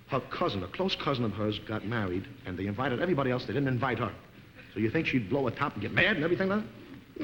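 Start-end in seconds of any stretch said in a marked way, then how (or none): none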